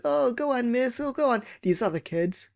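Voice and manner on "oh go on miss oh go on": high pleading whine; whiny begging. Retold or imitated. Pupil pleads with strict teacher, high whine. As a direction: beg the teacher like a child.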